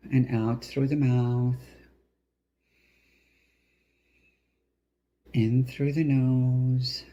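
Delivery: slow meditation voice with drawn-out final words; guided breathing. Acted. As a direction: pace each phrase slowly with the breath.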